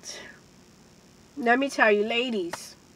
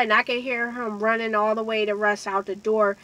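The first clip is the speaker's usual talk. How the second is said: ordinary talk, nothing marked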